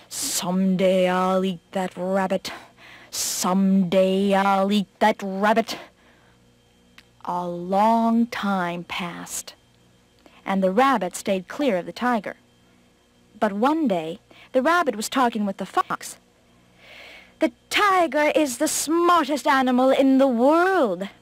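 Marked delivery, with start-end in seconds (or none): 0.1-5.8 slow, drawn-out tiger growing determined; tiger's sworn vow. Acted. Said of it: "he swore again and again". 17.4-21.1 lightly voiced fox, pitched higher; a higher storybook fox making a claim; fox character voice. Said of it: "said the fox".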